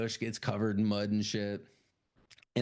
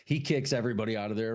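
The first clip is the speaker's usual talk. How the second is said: usual speaking voice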